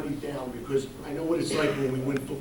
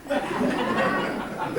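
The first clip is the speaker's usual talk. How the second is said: high, breathy mock little-girl "Dad"; mimicking his daughter. Coach imitating his daughter begging for a pendant, high mock voice.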